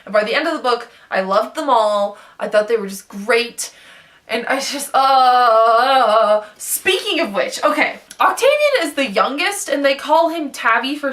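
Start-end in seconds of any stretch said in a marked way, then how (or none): 4.9-6.4 drawn-out, loud "uh" groan; long loud groan. Real, not acted. Book fan overcome while gushing about the characters, long loud groan.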